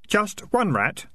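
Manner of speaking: high, slow, overly helpful; mock helpful. A narrator's reading. Boy taunting the piper with fake helpfulness, high and slow. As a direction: needle him with slow sweetness. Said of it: "said Keith, helpfully".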